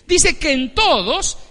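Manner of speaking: loud, high sermon build; full-force preaching. Acted. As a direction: drive each phrase home from the pulpit.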